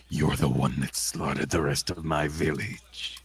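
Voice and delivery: low, hushed, seething accusation; grim accusation. Acted. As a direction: quietly accuse the killer. Accusing the village's killer.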